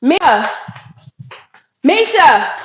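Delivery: loud call across the house; shouting for her daughter. Real, not acted. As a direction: call her name to another room. Calling daughter again.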